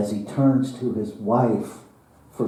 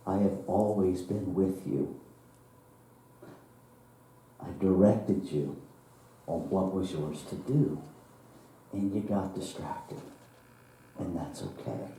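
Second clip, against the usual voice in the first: soft, patient explanation, getting softer; gentle God; 3 semitones lower, 8 dB quieter than usual. Acted. God tenderly explains to the man he was never alone, softly. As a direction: reassure him without blame, voice sinking low.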